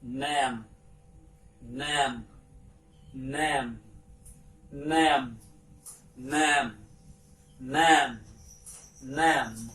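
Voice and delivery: "nam" said again and again, one syllable at a time; modelled pronunciation. Shown as a demonstration. Teacher modelling a word for pupils to repeat. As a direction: repeat "nam" clearly so the class can copy. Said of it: "we pronounce".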